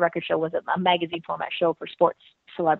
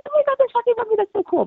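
high excited fan squeal; starstruck squeal; 3 dB louder, faster than usual. Retold or imitated. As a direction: gush like a starstruck fan girl. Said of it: "I was so like".

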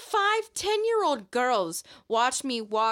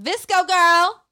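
loud, drawn-out mocking call; yelled taunt; 8 semitones higher, 13 dB louder, slower than usual. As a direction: yell it from a bus window. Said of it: "and was like".

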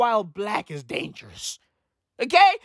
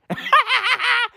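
high-pitched burst of laughter; high cackle; 10 semitones higher than usual. Real, not acted. Comedian cracking up after his own impression, high cackle.

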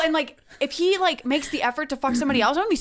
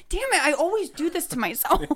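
ordinary talk

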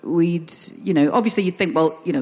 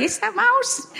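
dad's voice, much higher; slower than usual. Retold or imitated. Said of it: "I woke up to them having a little chat".

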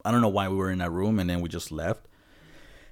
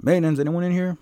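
high, louder call into the room; 10 semitones higher, 6 dB louder, faster than usual. Retold or imitated. Checking the restroom before cleaning, raised call. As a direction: call out to check if anyone's inside.

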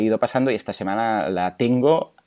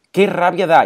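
exasperated exclamation; 6 semitones higher, 5 dB louder than usual. Real, not acted. Exclaiming annoyance at first dent.

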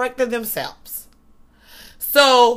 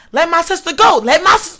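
high-pitched retold holler, moderately raised; pitched-up yell, short of a real scream; mock scream; 6 semitones higher, 4 dB louder than usual. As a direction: imitate a sister's frantic demand. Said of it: "And she just screaming".

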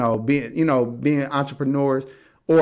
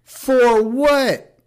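high, drawn-out incredulous question; comically baffled, high-pitched wail; exaggerated disbelief; 10 semitones higher, much slower than usual. Real, not acted. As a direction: stretch it out in mounting disbelief.

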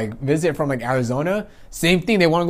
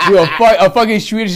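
ordinary talk, nothing marked

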